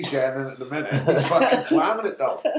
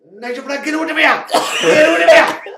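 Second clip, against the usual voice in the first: higher, louder retold yell at a pupil; mock teacher shout; 12 semitones higher, 7 dB louder than usual. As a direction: bellow him out of the class like the teacher. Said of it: "he kicked him out".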